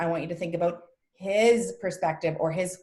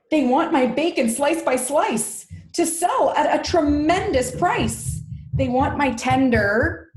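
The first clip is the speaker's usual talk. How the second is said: higher, louder, emphatic character reading; indignant storybook pig; 8 semitones higher, 6 dB louder than usual. Acted. Pig realises what the farmer wants of him, indignant outcry. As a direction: declaim the pig's complaint, loudly. Voices the pig's speech.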